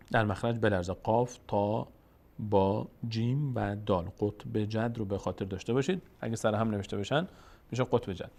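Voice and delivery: ordinary talk, nothing marked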